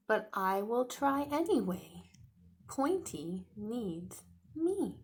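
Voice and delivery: hammed-up young dinosaur, sorry for himself; little dinosaur voice. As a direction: play the sad little T-Rex. Voices Tiny Rex reading story.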